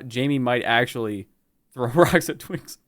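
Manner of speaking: usual speaking voice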